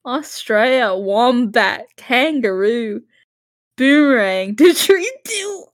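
hammed-up Australian accent, breaking up at the end; shitty Australian accent. Answering in an Australian home invasion script, bad Australian accent. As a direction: punch each word in bad Australian. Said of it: "And then the response is".

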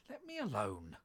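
loud, indignant demand. A narrator's reading. Alice tells the beating pigeon off, indignant. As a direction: push the pigeon away with the voice.

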